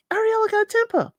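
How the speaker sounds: high, fast mock-shocked quip; imitating Gabriel. Gabriel quipping to the room about Ariella's temper, high and fast. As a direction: toss it off fast and high, playing the shocked new guy. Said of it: "He's like".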